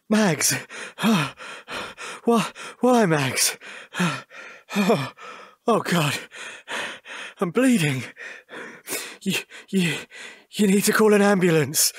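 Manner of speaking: hurried, breathless plea; wounded panic. Acted. Stabbed husband begging his wife for an ambulance, panicked. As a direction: plead for help while stabbed.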